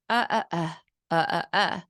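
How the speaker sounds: "uh, uh, uh" for umbrella, consonants dropped; three bare "uh" syllables; vowels only. Shown as a demonstration. Imitating a child saying umbrella as vowels only. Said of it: "they might say".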